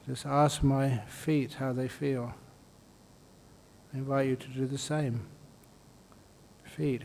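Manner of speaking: soft, unhurried meditation voice with long pauses; guided meditation. Real, not acted. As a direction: gently guide them through the body, softly. Leads a body-scan meditation.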